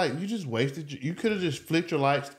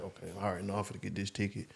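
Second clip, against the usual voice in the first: much softer, lower mumble to self; resigned muttering; 5 semitones lower, 12 dB quieter than usual. Retold or imitated. Driver mutters to himself checking his bank, soft and low. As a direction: grumble it resigned to the ticket. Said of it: "I'm like".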